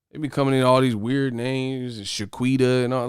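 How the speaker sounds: ordinary delivery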